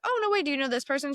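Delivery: high, bright faked surprise; chatting up a stranger at the table with exaggerated delight; mock excited. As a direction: gush with fake delight. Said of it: "And I was like".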